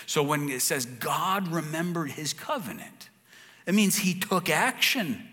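normal talking voice

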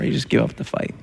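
breathy whispered resignation; a preacher whispering defeat. Acted. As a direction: let it fade to a whisper. Preaching about losing the will.

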